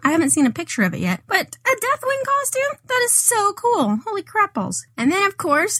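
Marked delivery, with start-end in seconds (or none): 1.3-4.8 excited. Real, not acted. Amazed at the costume news.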